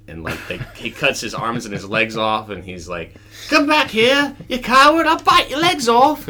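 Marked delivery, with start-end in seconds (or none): 3.5-6.3 very high, loud defiant shout. Acted. Limbless knight taunting a coward, defiant high yell. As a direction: taunt a fleeing foe at full pitch.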